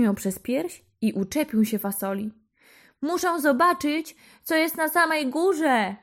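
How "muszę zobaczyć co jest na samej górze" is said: put-on high, excited child's call; a boy shouting up from the beanstalk; higher boyish voice calling to mum; eager boy calling. A narrator's reading. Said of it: "zawołał do mamy".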